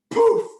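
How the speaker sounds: high, loud "poof"; sudden high-pitched poof; magic poof. Pretend. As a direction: burst it out like a magic puff. Magic effect in story.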